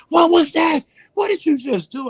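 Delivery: high, loud imitated shriek of terror; panicked shriek. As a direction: squeal it out in horror. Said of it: "He's like".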